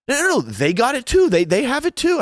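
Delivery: high, hurried imitation; mimicking her trying to save it, high; mock flight attendant.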